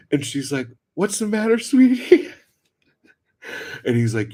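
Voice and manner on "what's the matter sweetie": high, loud sing-out; mock sweet holler, pitched way up; calling down sweetly. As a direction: imitate an oblivious neighbor cooing loudly. She calls down from window.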